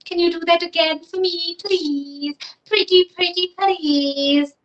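high, pleading princess voice; cartoon princess. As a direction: beg sweetly like a little princess. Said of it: "she said".

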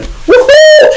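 high loud woohoo; gleeful whoop. Real, not acted. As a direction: cheer it like good news. Celebrates the beating.

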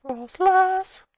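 high, loud cry of the name; excited shout. Real, not acted. Gamer calls out the Pokémon Frostlass, excited shout.

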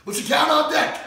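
loud drill-sergeant call; military call-out. Acted. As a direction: shout it like roll call.

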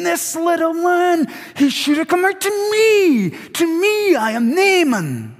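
high-pitched, outraged rant, hammering TO ME; furious Naaman. Acted. Preacher acting Naaman snubbed by Elisha, furious. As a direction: cry it out with wounded, self-important rage. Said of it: "This is Naaman furious, saying".

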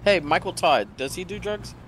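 usual speaking voice

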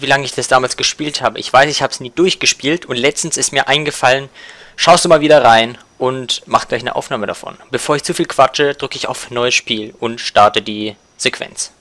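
ordinary talk

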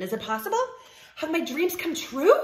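hopeful upward tune through "come true"; questioning. Acted. Pigeon hoping for a puppy, "come true" rising as a question. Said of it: "He's asking a question".

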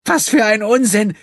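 high, loud indignant outburst; protesting. A narrator's reading. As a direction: flare up in defiance. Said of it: "begehrte Gina auf".

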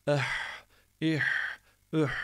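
"eh, ih, üh" with a tight breathy pharyngeal H; squeezed throat. Shown as a demonstration. Teacher demonstrating the letter ha, tight-throated "eh, ih, üh". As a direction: squeeze the throat on each long final H. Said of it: "boğazı sıkarak".

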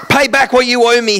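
high, loud furious demand; angry demand. Acted. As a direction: bark the order at the debtor. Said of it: "he demanded".

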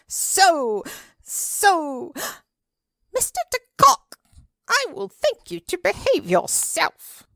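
put-on a prim old lady shrieking in outrage; indignant old lady. Old lady scolds Monsieur de Kock for kicking, shrill protest. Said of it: "interrupted an old lady".

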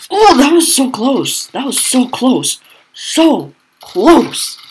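high, loud exclaiming; agonized near-miss outcry. Real, not acted. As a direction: cry out over a near miss. Narrowly misses another shot.

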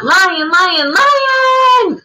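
lion, lion cried out high and loud; alarmed shout. Acted. Teacher reading the story to the class, shouting the alarm. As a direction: sound the alarm like a frightened animal.

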